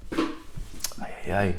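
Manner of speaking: hushed, halting mock sob; imitated crying. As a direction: imitate a tearful caller struggling to start. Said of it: "a lady starts crying".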